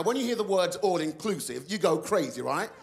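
ordinary talk

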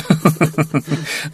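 real amused laughter; chuckle. Real, not acted. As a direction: chuckle while teasing a student. Teasing the student over slow answers. (학생을 놀리며 터뜨리는 웃음)

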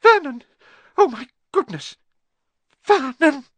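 very high, loud cries of alarm; panicked high-pitched exclamations; shocked shriek. A narrator's reading. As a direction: cry out in horrified disbelief. Aunt choking in shock at letter.

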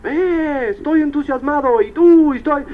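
high-pitched imitation of a whooping crowd; mock excited yelling. Convention-goers yelling to one another, mock excited shouting. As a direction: imitate overexcited people hollering at each other. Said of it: "Gritando uno a otro".

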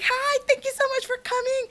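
lightly mimicked high, sweet thank-you; imitating a sweet idol. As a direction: mimic his cute polite greeting. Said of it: "And he's just like".

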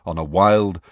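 ordinary delivery

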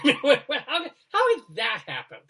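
laughing disbelief. Real, not acted.

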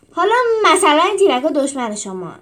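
loud bellowing announcement. A narrator's reading. Trainer bellowing at the recruits about the posts, loud. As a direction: bellow it across the arena. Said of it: "نره زد".

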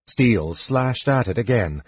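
ordinary delivery, nothing marked

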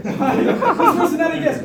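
high, laughing through the words; amused, high-pitched and drawn out. Real, not acted. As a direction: tell it loudly through laughter. Laughing while retelling absurd office rule.

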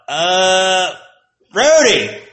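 high, loud drawn-out roll call; mocking teacher. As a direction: call the name like a smug teacher.

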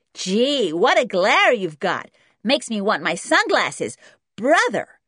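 put-on loud, high, cheeky kid; mocking American boy. A narrator's reading. Junior teasing George about the glare, mocking and loud. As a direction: jeer it like a brash American child.

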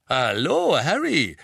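beaming. A narrator's reading. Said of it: "strahlte ihn an".

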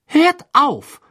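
high, loud snap; irritated. Acted. Ginny scolding the laughing boys, irritated. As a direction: cut them off, annoyed. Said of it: "sagte Ginny gereizt".

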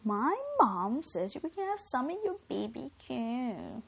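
exaggerated child's voice with big pitch swoops; hammed-up kid passing on mum's message; silly put-on little-kid voice; weird kid voice. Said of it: "weird voice".

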